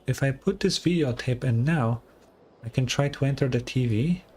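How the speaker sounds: ordinary talk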